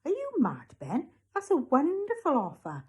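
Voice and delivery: protest, aghast at the refusal; incredulous. Acted.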